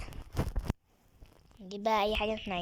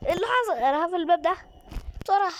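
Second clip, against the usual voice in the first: frightened yelling; 7 semitones higher, 6 dB louder than usual. Real, not acted. Panicking, shutting the door.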